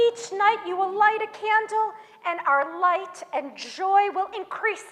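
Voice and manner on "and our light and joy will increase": plain reading voice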